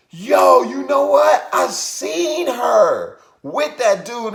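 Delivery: high, loud revelation; excited gossip. Retold or imitated. Hotel manager revealing he saw the girl, excited gossip. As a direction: imitate someone bursting with gossip.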